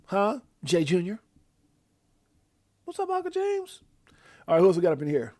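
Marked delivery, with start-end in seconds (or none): 2.8-3.8 put-on high, kid-like greeting to his uncle; nephew voice. Uncle on stream voicing his nephew's high greeting. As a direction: pipe up like the little nephew. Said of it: "and my little nephew".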